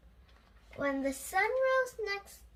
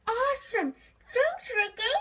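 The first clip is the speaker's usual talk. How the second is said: high, loud delighted shout; Lucy thanks Ricky, thrilled by the surprise; excited girl; 9 semitones higher, 5 dB louder than usual. Acted. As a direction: squeal with joy at the gift.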